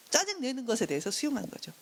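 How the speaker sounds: usual speaking voice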